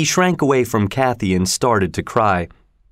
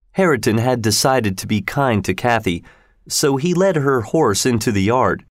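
ordinary delivery, nothing marked

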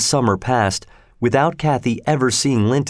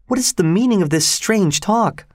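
put-on high-pitched questioning girl character; pitched-up, puzzled young woman; demanding girl; 9 semitones higher than usual. A narrator's reading. Kathy demands an explanation from Linton.

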